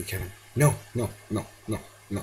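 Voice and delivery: ordinary delivery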